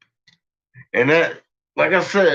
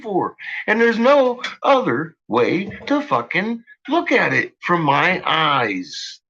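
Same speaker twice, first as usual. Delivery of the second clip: heated rant; 9 semitones higher than usual. Real, not acted. Ranting about bullets and veterans.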